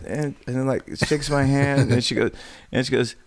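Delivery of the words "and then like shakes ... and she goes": ordinary talk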